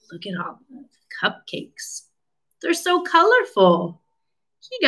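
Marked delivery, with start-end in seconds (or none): 0.1-2.0 whispered, much softer; hushed amazement; awed whisper. Acted. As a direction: breathe it out in wonder.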